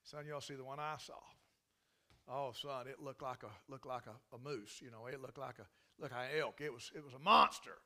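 low, lightly voiced boastful old hunter; a hint of a tall-tale-telling hunter; bragging hunter. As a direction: brag about the big one as a know-it-all. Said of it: "and somebody says".

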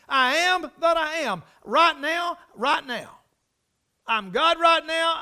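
loud, high, emphatic preacher's delivery; impassioned preaching. Acted. Preacher proclaiming God's eternal presence to the congregation, impassioned preaching. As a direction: drive each repeated phrase home with force.

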